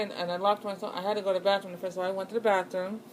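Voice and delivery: ordinary delivery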